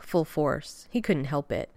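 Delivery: plain reading voice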